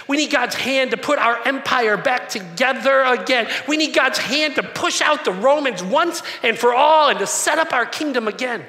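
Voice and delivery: rally the crowd for the kingdom; eager crowd. Retold or imitated. Preacher voicing the crowd demanding God's favor, loud and rallying. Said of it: "They were like".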